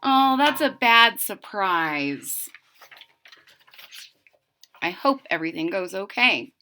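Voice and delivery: plain reading voice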